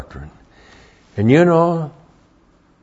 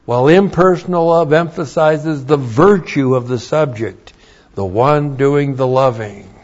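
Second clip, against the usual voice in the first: louder emphatic sermon delivery; preaching; 5 semitones higher, 5 dB louder than usual. Acted. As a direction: drive the doctrine home from the pulpit. Preaching continues.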